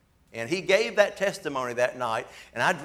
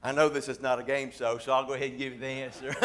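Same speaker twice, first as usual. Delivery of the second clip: ordinary talk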